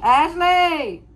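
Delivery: high, loud drawn-out call. Pretend. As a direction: holler the name out high and long. Calling out Ashley with the toddler.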